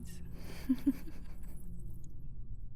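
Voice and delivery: long whispered chuckle; breathy villain laugh. Acted. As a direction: laugh at the thought of the blade. Villain relishing cruel game.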